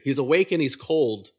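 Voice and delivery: loud, sudden call; alarmed announcement. Retold or imitated. Staff calling out as the patient jolts awake, loud alarm. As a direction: sound the alarm that he's up.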